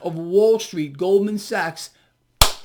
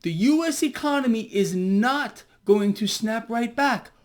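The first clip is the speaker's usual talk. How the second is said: usual speaking voice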